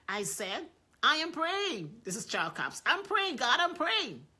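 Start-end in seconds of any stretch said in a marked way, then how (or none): 1.0-2.0 high, loud and slow insisting; insistent. Retold or imitated. Acts Capps insisting. 2.8-4.3 high, loud protest; insistent. Retold or imitated. Acts Capps insisting.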